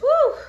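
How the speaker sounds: a high, loud, blown-out whew; overwhelmed whew. Real, not acted. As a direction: let the breath burst out high. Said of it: "I'm just feeling so overwhelmed".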